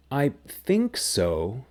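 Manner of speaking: I think so, slow and rising, stress on think, doubtful; unsure I think so. Shown as a demonstration. Teacher models an unsure I think so, slow and rising. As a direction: draw out think and lift so at the end, unsure. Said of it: "What does that mean?".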